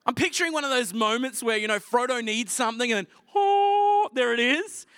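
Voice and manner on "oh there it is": surprised oh. Retold or imitated. Frodo finding the sword.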